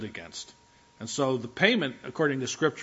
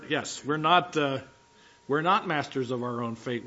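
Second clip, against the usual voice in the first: ordinary talk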